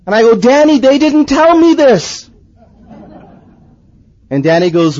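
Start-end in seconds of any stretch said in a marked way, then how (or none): 0.4-2.3 high-pitched, mildly indignant complaint; acted protest. New convert protesting to his friend, mock dismay. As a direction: protest as if caught off guard.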